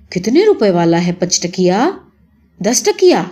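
ordinary delivery

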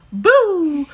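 loud playful ghost shout; jump-scare someone as a ghost; spooky boo. Pretend. Playing a ghost.

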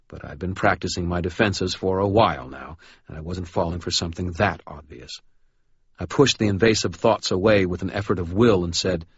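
ordinary delivery, nothing marked

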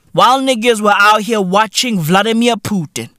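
ordinary talk, nothing marked

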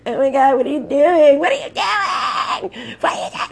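high, loud mock shriek; freaking-out passenger. Front-seat passenger as someone opens the car door, hammed-up panic. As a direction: imitate a panicked shriek, stretching it out as it goes. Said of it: "totally freaks out".

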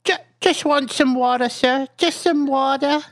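put-on high, meek little kid voice; small child voice. Player's small child begging the bartender for water, pitched high and meek. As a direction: play it as a timid little boy asking.

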